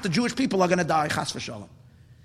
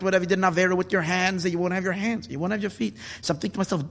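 ordinary talk, nothing marked

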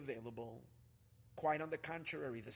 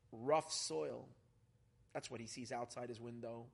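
ordinary talk, nothing marked

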